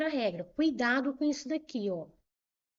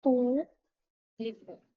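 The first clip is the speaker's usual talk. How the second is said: "Público" broken slowly into syllables, with pauses; separando em sílabas; much slower than usual. Shown as a demonstration. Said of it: "Vou separar em sílabas". ("Público" devagar, sílaba por sílaba)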